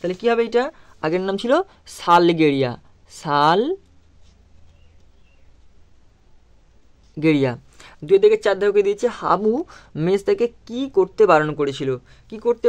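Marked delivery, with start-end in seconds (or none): 3.1-7.6 very slowly drawn out, word stretched for writing down; slow dictation. Shown as a demonstration. Teacher dictating the answer to pupils, very slow and drawn out. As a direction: spell it out slowly so the class can write.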